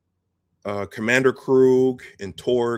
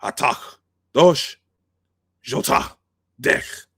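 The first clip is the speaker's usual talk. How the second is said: Klingon bark; 3 semitones higher, 5 dB louder, slower than usual. Retold or imitated. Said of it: "he barks out".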